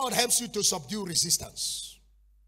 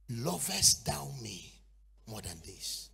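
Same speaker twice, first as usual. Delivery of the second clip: 1 tender, slow Jesus; 8 semitones lower, 3 dB quieter, slower than usual. Acted. Jesus asks Peter tenderly.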